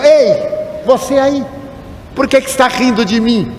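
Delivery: high-pitched challenge, only mildly shouted; imitated angry call. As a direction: call out a stranger across the queue. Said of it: "E ele gritou". (grito imitado, agudo, de quem cobra satisfação)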